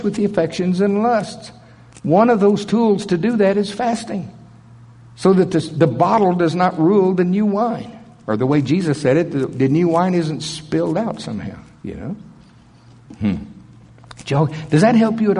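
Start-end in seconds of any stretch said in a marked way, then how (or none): none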